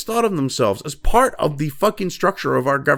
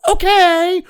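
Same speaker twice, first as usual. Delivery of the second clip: a drawn-out, higher mock "Okay"; mock compliant okay; 11 semitones higher, 4 dB louder than usual. Refusing to meekly nod along: mock okay. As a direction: play the meek pushover agreeing. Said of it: "sit there and go".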